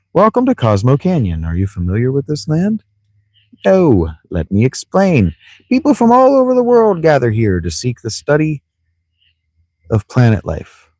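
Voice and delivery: high, swooping greeter voice; welcoming gatekeeper. Acted. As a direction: give a theatrical welcome speech.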